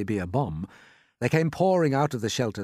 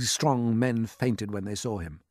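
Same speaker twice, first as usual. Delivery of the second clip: ordinary delivery, nothing marked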